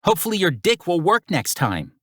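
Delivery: high, mocking Brooklyn parting shot; Brooklyn accent. A narrator's reading. Mirabella insulting Axel on the way out, Brooklyn accent. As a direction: jab him in a thick Brooklyn accent. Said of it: "her Brooklyn accent stronger than ever".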